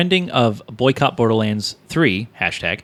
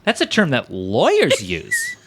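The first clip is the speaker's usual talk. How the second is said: put-on slow, talking down to a child; condescending; 9 semitones higher than usual. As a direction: explain it like to a toddler, laughing. Mock explaining to a child.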